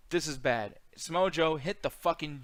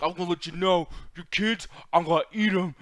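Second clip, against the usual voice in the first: louder and higher, slowing to a drawn-out threat; mock menacing promo; 5 semitones higher, 11 dB louder, slower than usual. Mocking Samoa Joe's promo with a loud hammed-up threat. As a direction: ham up a wrestler's threat to eat kids. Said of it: "and he's like".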